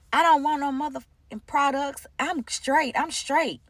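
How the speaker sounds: upset client. Retold or imitated. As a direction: imitate her cussing out the offer. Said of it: "she got really upset".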